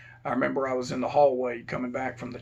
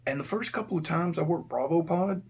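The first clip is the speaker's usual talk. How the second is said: ordinary talk, nothing marked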